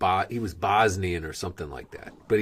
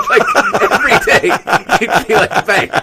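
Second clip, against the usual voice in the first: laughing; 12 semitones higher, 10 dB louder than usual. Real, not acted. Laughing while retelling the joke.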